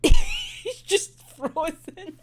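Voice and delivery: words squeezed out slowly through real laughter; can barely get it out for laughing. Real, not acted. Laughing at frozen shopkeeper.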